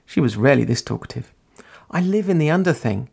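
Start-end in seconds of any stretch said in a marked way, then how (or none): 1.9-3.1 high-pitched, easy statement; easy, offhand. A narrator's reading. Girl explaining where she lives, easy. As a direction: say it lightly and easily, high. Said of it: "Ori said easily".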